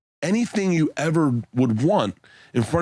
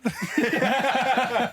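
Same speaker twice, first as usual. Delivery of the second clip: laugh; 11 semitones higher than usual. Real, not acted. Bert laughs at his own rant.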